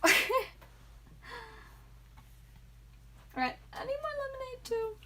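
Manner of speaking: high, fast squeaky voice. Real, not acted. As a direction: squeak it out, a bit loopy. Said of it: "I'm talking in a weird squeaky voice".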